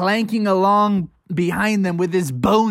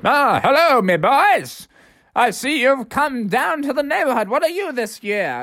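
put-on higher, cheery old-timer voice; higher, lightly voiced rabbit dentist; jolly dentist rabbit; 9 semitones higher than usual. A narrator's reading. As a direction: welcome the kids like a jolly neighbor. Mister Rabbit dentist greets kids.

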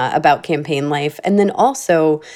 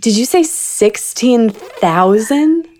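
slow, higher, astonished question; incredulous; 4 semitones higher, slower than usual. Real, not acted. Host checking a guest's huge post count, incredulous. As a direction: draw out the number in disbelief.

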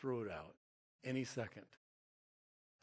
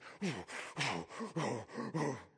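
heavy huffing bear breaths; bear breathing. Retold or imitated. Telling how the bear loomed over his face, heavy imitated bear huffing. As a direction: huff hard like a bear, breath after breath.

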